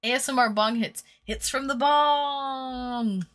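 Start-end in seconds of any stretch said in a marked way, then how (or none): 1.2-3.3 mock ASMR drawl. Mock ASMR bong line.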